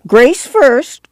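put-on high, insistent old grandma; clamor for it shrilly. A narrator's reading. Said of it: "Grandma clamored".

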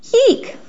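a startled little yelp; high yip. A narrator's reading. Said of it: "Pip yipped".

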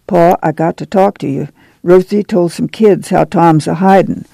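ordinary delivery

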